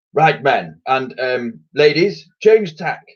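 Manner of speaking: loud commanding admiral boom. Acted. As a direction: boom the new order at the crew. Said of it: "boomed the old sailor".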